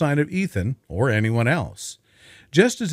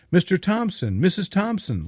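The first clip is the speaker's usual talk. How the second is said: higher, cracking with fear; frightened shout; 6 semitones higher than usual. A narrator's reading. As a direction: call out to the neighbours in fear. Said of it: "Lucas shouted, his voice cracking with fear".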